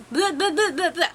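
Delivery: bouncing run of "blah blah blah"; tongue-tied babble. Real, not acted. Vlogger losing the sentence midway, playful tongue-tied babble. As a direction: babble to shake off jumbled words.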